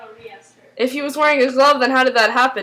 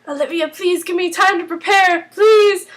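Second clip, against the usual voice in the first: imitated pleading; 8 semitones higher than usual. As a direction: beg theatrically for more time. Voices a character pleading.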